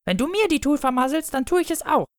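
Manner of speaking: hoch und keifend fauchen. Acted. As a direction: faucht den Bruder hoch und giftig an.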